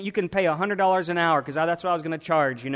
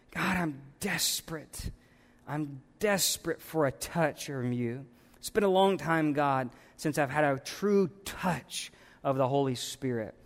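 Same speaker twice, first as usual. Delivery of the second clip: softer, near-whispered plea; desperate quiet prayer; 3 semitones lower, 6 dB quieter than usual. Acted. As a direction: breathe it out, worn and pleading.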